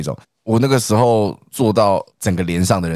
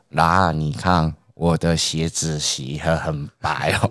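very slow, lower, softer proud drawl; slow dopey friend; 6 semitones lower, 5 dB quieter, slower than usual. Retold or imitated. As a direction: show off shoes like a simple recruit. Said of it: "就是讲话很慢".

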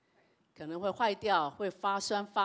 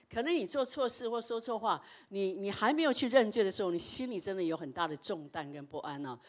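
usual speaking voice

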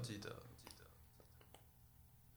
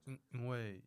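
hesitant stammer; 4 dB quieter than usual. Acted. Girl cornered by questioning.

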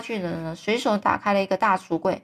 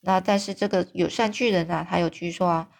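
usual speaking voice

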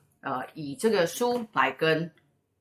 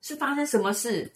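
much higher, coaxing her to stop crying; comforting friend; 10 semitones higher than usual. Retold or imitated. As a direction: soothe her like a startled friend. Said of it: "他说".

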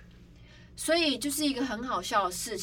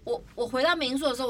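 ordinary talk, nothing marked

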